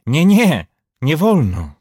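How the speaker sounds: loud, high cry of refusal. A narrator's reading. Man fighting off temptation, crying out in refusal. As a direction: call it out in desperate protest. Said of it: "zawołał".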